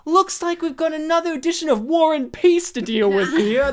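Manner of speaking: high, loud mock teacher quip; quipping teacher. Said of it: "she'll be like".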